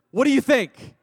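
sudden high, loud burst; shouted challenge. Retold or imitated. Candidate challenging the preacher interviewing him, loud punchline shout.

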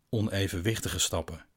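ordinary delivery, nothing marked

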